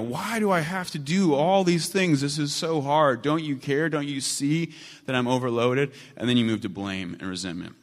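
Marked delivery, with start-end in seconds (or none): 0.0-5.9 high, pleading wail of overload; crying out to God. Retold or imitated. Overloaded believer crying out to God, high pleading wail. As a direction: cry out high, begging God to notice. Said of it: "crying out to God".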